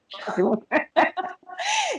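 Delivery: bright burst of real laughter. Real, not acted. Mother laughing at her son's invented word.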